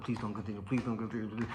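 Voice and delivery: low, soft muttered begging; pleading under breath. Retold or imitated. Exhausted boxer hoping opponent quits, hushed plea. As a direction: plead quietly so nobody hears.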